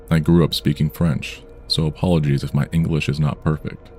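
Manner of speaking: plain reading voice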